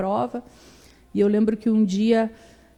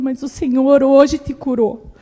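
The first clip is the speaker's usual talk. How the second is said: firm declaration; 4 semitones higher, 7 dB louder, slower than usual. Retold or imitated. Grandmother declaring healing.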